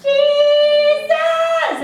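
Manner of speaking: high, loud, squeezed belt of Jesus; bright brassy tinny. Shown as a demonstration. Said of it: "a really bright, brassy, tinny sound".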